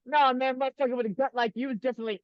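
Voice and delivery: high, fast mock character rant. Quoting Trailer Park Boys, high fast character voice. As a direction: rattle it off like the show. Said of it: "quoting Trailer Park Boys".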